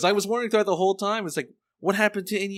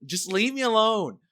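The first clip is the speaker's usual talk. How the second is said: much higher, nagging mock voice; pestering; 5 semitones higher than usual. Inuyasha pestering Kagome to say it, high nagging. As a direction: nag and nag until she gives in.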